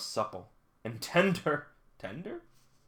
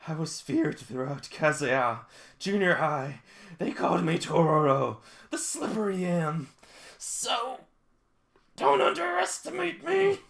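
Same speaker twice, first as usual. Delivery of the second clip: mock demon girl; 2 semitones higher, 6 dB louder than usual. Girl boasts to senpai, mock menacing. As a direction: brag it like a villain. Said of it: "she's like a demon".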